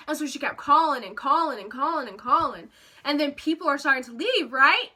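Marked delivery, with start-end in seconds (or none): none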